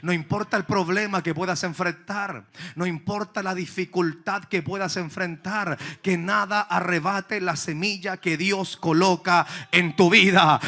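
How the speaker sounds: ordinary talk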